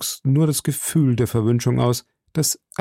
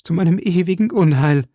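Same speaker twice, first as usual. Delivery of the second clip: higher, louder wail peaking on ewigen; paroxysm of grief; 6 semitones higher, 4 dB louder than usual. A narrator's reading. Milady laments to Felton, peak of despair. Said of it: "als wäre sie von einem Paroxysmus des Schmerzes befallen".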